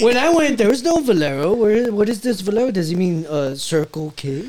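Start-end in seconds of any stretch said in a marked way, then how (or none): 0.0-2.7 hammed-up fake Swedish, starting high and loud; badly faked Swedish accent; mock Swedish accent. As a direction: play a puzzled Swede in a bad accent. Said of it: "is that Swedish?".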